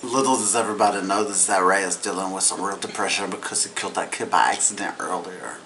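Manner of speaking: quiet, fast aside; hushed aside. Real, not acted. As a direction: drop it down, rush through. Hushed aside about depression.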